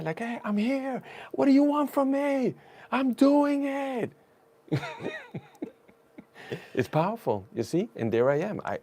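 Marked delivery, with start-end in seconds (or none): 0.2-4.1 high, loud cry flung upward, last words stretched slowly; screaming to the sky. Retold or imitated. Runner on a dark street, shouting up at the sky. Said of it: "I'm screaming out into the sky". 4.7-5.4 high laugh. Real, not acted. Laugh after the shout.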